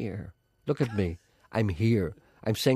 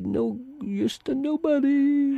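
squeaky; much slower than usual. Acted. Mock outrage rant.

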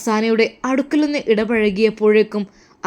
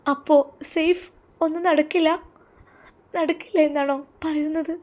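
higher, worried questioning; anxious wife; 7 semitones higher than usual. Acted. Wife asking Saif if nothing will happen, higher and anxious. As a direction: fear the plan has failed.

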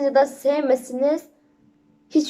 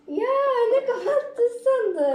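laughing shout; 10 semitones higher, slower than usual. Real, not acted. Teasing someone off camera.

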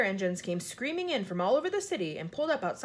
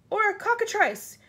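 high, loud exclamation; alarmed yell; 12 semitones higher, 7 dB louder than usual. A narrator's reading. Police chief naming the monster, yelling. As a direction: yell out another dreadful guess. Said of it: "yelled the f-, chief of police".

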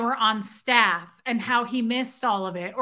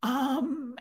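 imitating someone about to cry, words drawn out; hesitant, softer, on the edge of tears; starting to cry; 3 dB quieter than usual. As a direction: mimic a speaker faltering before tears. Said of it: "she starts to cry".

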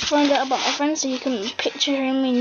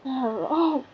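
ordinary talk, nothing marked

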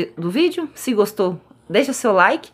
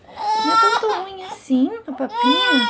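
much higher cooing reassurance; 12 semitones higher than usual. Real, not acted. Mother coaxing her crying son to eat, high cooing baby talk.

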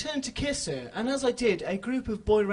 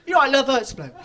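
loud run-on line, no pauses; laughing, louder single-breath sentence; run-together quote; 4 semitones higher, 11 dB louder, faster than usual. Retold or imitated. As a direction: blurt it in one breath, louder. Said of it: "whilst making a perfect forty-five degree angle".

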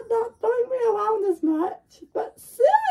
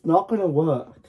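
deep cartoon voice. Deep character comment.

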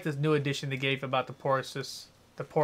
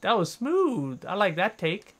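delighted; 6 semitones higher, 7 dB louder, slower than usual. Real, not acted. Pleased after kill.

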